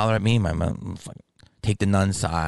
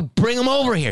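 very high, exasperated order; retold challenge, voice shooting up; heated demand. Demanding the mother fetch her son, heated.

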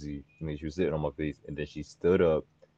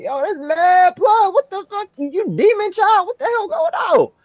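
high, loud, slow alarmed yelling; mock freak-out; 6 dB louder, slower than usual. Retelling finding blood, shouting high at her. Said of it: "I was like".

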